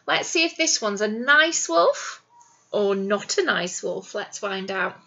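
ordinary delivery, nothing marked

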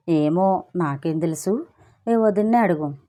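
ordinary delivery

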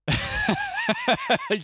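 sudden loud high burst of laughter; hearty high laugh. Real, not acted. Laughing at family quiz.

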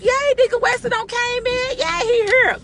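high, celebratory welcome; excited cheering. Retold or imitated. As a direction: cheer his arrival like a happy crowd. Group cheering an absent deacon's return.

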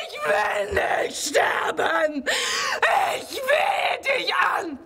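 high, tearful begging with breaking sobs; overacted stage weeping, begging not to die; hammed-up pleading. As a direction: plead for your life like bad old theatre. Melodramatic plea for life in play.

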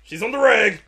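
high, loud panicked character voice; mock panic. Friend retelling a game scene, a mock panicked squeal. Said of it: "he's like".

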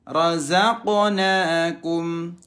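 chanted tajwid with bounced q; Quran recitation. Shown as a demonstration. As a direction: recite slowly, every q audible. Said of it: "qafnya harus kedengeran". (bacaan tajwid dengan qalqalah jelas)

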